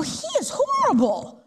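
high, scornful aside; high disgusted gossip. Retold or imitated. Leanne judging the bald man to Connie, scornful and high. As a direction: sneer it to your friend.

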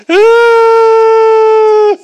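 high loud drawn-out whine; whiny wail. Retold or imitated. Blind men crying out in a whiny wail. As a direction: wail like a complaining beggar. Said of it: "these blind men were like".

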